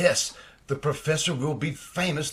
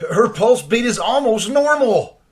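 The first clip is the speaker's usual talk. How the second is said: excited; 9 semitones higher, 10 dB louder than usual. Acted. Assistant reports patient recovering.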